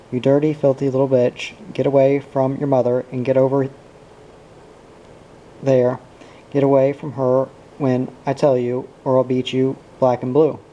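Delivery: ordinary delivery, nothing marked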